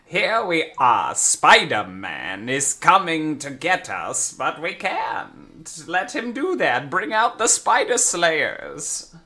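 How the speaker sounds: slow old-cartoon mid-Atlantic villain; drawn-out mid-Atlantic villain, savoring each word; mid-Atlantic accent. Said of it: "that mid-Atlantic accent".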